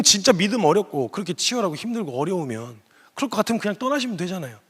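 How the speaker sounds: ordinary delivery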